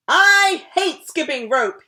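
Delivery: loud, slow angry yell. Acted. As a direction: let the temper burst out. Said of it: "he yelled".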